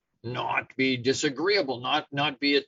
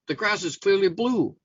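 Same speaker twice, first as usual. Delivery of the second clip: mildly indignant donkey, pitched up; insistent; 7 semitones higher than usual. Acted. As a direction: press the point, higher and emphatic. Donkey complains to the lion.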